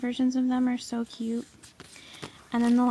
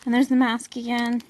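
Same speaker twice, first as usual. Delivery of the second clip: normal talking voice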